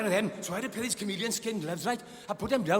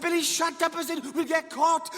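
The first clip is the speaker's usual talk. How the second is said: retold panicky telling-off; 8 semitones higher than usual. Hushing shouting Billy in panic.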